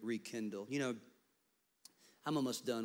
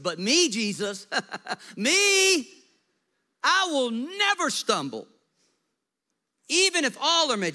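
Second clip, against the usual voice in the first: high, loud boasting Peter; squeaky, puffed-up voice of a bragging friend; boastful Peter; 13 semitones higher, 12 dB louder than usual. Retold or imitated. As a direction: crow it out like a cocky disciple. Preacher voicing boastful Peter.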